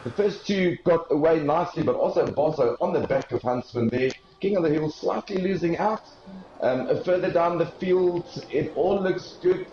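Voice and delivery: live play-by-play. Real, not acted. Live race commentary.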